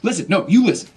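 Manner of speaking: high, loud irate phone rant; hammed-up outraged customer shouting; furious yelling. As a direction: yell down the phone at the provider. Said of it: "just yelling, like".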